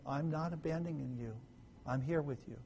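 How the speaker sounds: soft, gentle promise; calm them down with a hushed voice; quiet, steadying comfort; calming reassurance. Acted. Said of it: "To calm them down".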